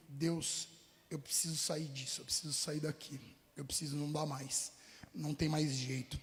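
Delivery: low, soft plea to God, near a murmur; quiet desperate prayer. Retold or imitated. Recalling his prayer to God, hushed and desperate. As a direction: pray under your breath, at the end of your rope.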